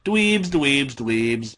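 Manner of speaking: a light mocking imitation, trailing off; mocking mimicry. A narrator's reading. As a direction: mimic the taunt, fading out. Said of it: "Pinkie mimicked".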